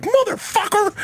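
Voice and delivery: high startled mock yell at an eel; retold yelp of fright.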